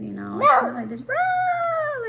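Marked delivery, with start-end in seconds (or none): 1.0-2.1 high, loud "rah" outburst; frantic mock roar. Shown as a demonstration. Mocking a freakout for listeners, loud frantic yell. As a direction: burst out in a wild, high yell. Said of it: "like, spaz thing".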